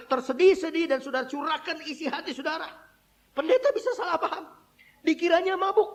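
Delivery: high-pitched, urgent exhortation; impassioned preaching. Real, not acted. Preacher warning that pastors can misunderstand, impassioned preaching.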